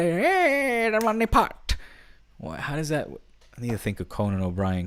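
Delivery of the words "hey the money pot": very high put-on leprechaun squeak; retry leprechaun voice. Podcaster retries his leprechaun bit, high squeaky mock voice. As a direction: try the troll voice once more. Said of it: "I can do it".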